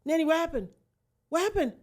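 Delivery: loud, high-pitched panicked questions; imitating a frantic sister; alarmed sister. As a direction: demand answers in sudden alarm. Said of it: "when she heard me say that".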